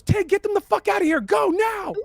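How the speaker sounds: high, loud urgent command; shouted order. Acted. Game master voices a woman ordering Tig to evacuate, urgent shout. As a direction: yell up at a comrade to get everyone out. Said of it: "she looks up at you, goes".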